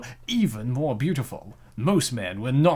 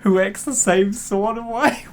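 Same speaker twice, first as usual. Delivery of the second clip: high, slow giggling words; laughing; 10 semitones higher, 7 dB louder, slower than usual. Real, not acted. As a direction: laugh through the line. Laughing at odd site name.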